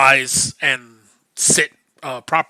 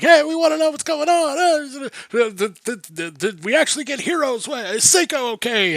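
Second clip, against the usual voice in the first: much higher villagers calling through a door; a squeaky, eager mob voice; clamouring crowd; 11 semitones higher than usual. Acted. Villagers outside demanding news, high clamouring voice. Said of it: "you hear people outside like".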